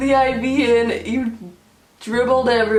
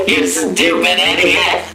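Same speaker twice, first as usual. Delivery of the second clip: deep, loud, slowed-down mock insult; drunken insult; 3 semitones lower, 6 dB louder, slower than usual. Drunk girlfriend insulting boyfriend, deep loud drawl. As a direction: drag it out low and loud like a drunk.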